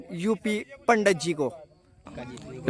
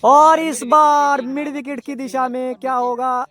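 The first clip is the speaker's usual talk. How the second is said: rising, drawn-out build-up call; excited cricket commentary; 7 semitones higher, 4 dB louder than usual. Real, not acted. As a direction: build suspense as the ball flies. Shot toward mid wicket. (रोमांचक cricket commentary)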